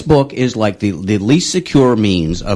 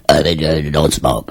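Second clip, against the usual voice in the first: mock Yul Brynner; 6 semitones lower than usual. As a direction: drop the voice low and drone. Imitating the dying Yul Brynner ad.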